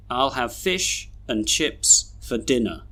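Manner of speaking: "and", "for" and end of "dinner" reduced to uh; slow model reading, "dinner" ending in a weak schwa; schwa weak forms. Shown as a demonstration. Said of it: "we should read these sentences like this".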